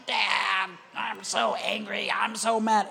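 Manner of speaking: raspy, drawn-out tantrum shout; mock angry child. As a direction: imitate a furious daughter yelling at her dad. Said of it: "if she comes at me".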